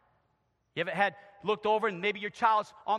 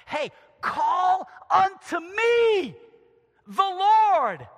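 slow, loud, high call from the Lord; booming divine summons; God calling out; 11 semitones higher, 12 dB louder, much slower than usual. Acted. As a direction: call out to Jeremiah with full force. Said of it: "And Jesus says".

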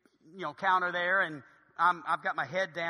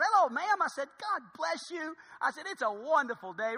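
high, sing-song chirpy hello; over-friendly greeting; 8 semitones higher than usual. Acted. Husband charming the gate agent, high over-friendly greeting. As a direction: greet with laid-on sweetness, pitched high.

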